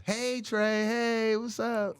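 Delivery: high, bright phone hello; cheery unsuspecting girlfriend. Retold or imitated. Friend's girlfriend answering before the bad news, high cheery greeting. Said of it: "When they answer the phone regular".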